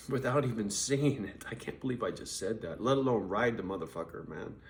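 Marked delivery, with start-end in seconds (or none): none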